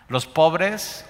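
ordinary delivery

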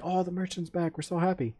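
mock the fans' happy cheer; mock fan excitement.

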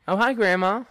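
high, loud mock sweet hello; mimicking a sweet greeting. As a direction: parrot the cohost's sugary greeting. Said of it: "echoing the cohost's greeting".

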